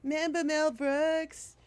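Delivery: high, sly tempting voice; mock nostalgia pitch. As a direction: dangle the memory like bait. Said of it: "it's, it's like".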